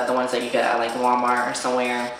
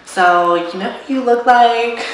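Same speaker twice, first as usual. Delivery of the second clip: imitating a girl at a bar; 10 semitones higher, 6 dB louder than usual. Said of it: "this girl was like".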